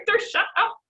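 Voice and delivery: high, loud, laughing cry of shut up; laughing embarrassed shout. Real, not acted. Player flustered by teasing friends, laughing and shouting. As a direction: yell it through giggles, flustered.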